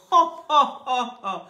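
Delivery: loud, high mock "oh" bursts; acted delighted exclamation. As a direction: exclaim like the thrilled madman. Patient delighted by serpent question. (exclamations ravies, jouées)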